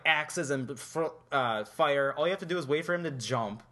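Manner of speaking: normal talking voice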